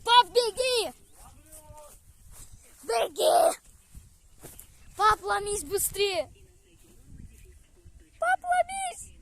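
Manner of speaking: frantic high shouting; yelling across the room. Real, not acted. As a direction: call out in a panic to dad. Child yells at dad fleeing wasps. (отчаянный крик папе)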